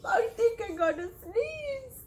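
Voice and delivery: high, pinched pre-sneeze voice; about to sneeze. Acted. Flea warning the listeners, high pinched voice. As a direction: sound like a sneeze is coming.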